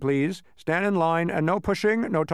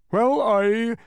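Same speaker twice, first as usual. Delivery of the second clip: high, loud halting start; stammered; 10 semitones higher, 7 dB louder than usual. Acted. The startled bug stammering to the census taker, flustered. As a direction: trip over the words, caught off guard. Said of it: "stammered the bug".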